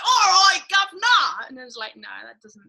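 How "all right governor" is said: hammed-up Cockney British accent, loud; mock British accent, cheery greeting; fake British accent. Host showing her fake British accent, loud mock Cockney. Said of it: "doing fake British accents".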